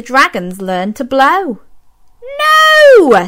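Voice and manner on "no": sharp high loud no; Madam Dragon's correction. A narrator's reading. Teacher dragon correcting pupils, sharp high no. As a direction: snap the no out high. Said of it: "said Madam Dragon".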